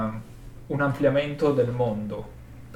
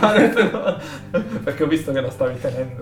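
ridendo; 2 semitones higher, 4 dB louder, faster than usual. Real, not acted. Laughing at the joke.

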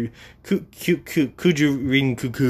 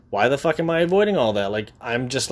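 ordinary talk

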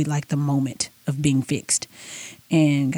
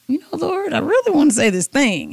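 mock begging; 9 semitones higher than usual. She begs God to speak.

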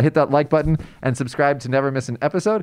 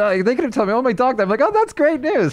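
much higher, put-on enthusiasm; mock cheery small talk; 12 semitones higher than usual. Host mimicking a how-are-you greeting exchange, mock cheery. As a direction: over-brightly play the delighted greeter.

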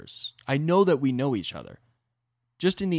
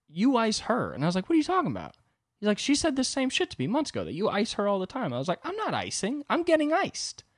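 much higher, rising indignation; heated back-and-forth; 10 semitones higher than usual. Retold or imitated. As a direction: argue it, getting more worked up. Retold argument with friend about icing.

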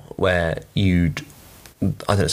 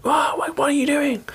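much higher, mock-outraged voice; really annoyed guest. Annoyed guest to housekeeper walking in, mock indignation. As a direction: imitate an annoyed guest in a high pitch. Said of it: "you get people really annoyed".

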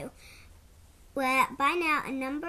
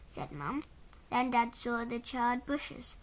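usual speaking voice

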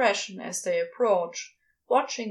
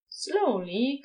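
ordinary delivery, nothing marked

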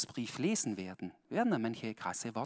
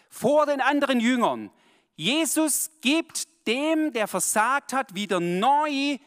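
loud, higher and slow; fervent slowed sermon emphasis; emphatic preaching; 9 semitones higher, 8 dB louder, slower than usual. Real, not acted. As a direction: drive the point home.

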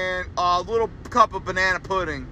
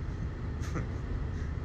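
soft unvoiced laugh through the nose; breathless silent laugh; 14 dB quieter than usual. Real, not acted. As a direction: let a quiet breathy laugh slip out. Laughs at own rant.

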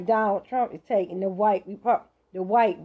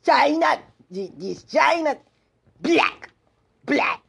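mock Trump emphasis; 6 semitones higher than usual.

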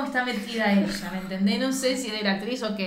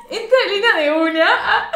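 high, loud, fast excited retelling; excited narration; 9 semitones higher, 6 dB louder than usual. Real, not acted. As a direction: blurt it out thrilled and laughing. Narrating a thrilling scene. (relato emocionado, rápido, agudo y fuerte)

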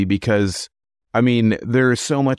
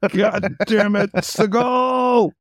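exasperated shout; 11 semitones higher, much slower than usual. Pretend. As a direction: yell his name in frustration. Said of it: "Imagine showing up to work".

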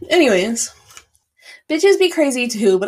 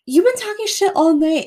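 high-pitched retold outburst; mock drunken accusation; losing it; 9 semitones higher, 4 dB louder than usual. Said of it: "and I lost my shit".

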